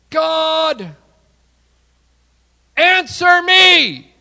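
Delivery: high, loud, drawn-out plea to God; desperate shout. Acted. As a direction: cry out on your knees, slow and desperate. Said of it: "I'm going to be on my knees going".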